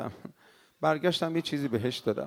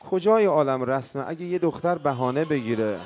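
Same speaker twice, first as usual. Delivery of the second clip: a lightly raised elegy; lament preaching; 3 semitones lower than usual. Acted. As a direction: mourn it aloud over the music. Lament over music.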